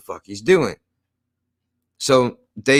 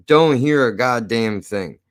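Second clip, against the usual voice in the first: ordinary delivery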